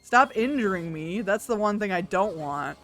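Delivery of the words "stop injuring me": usual speaking voice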